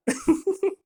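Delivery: real high-pitched giggle; amused giggle at your own joke. Real, not acted. Amused by own Hulk Hogan joke.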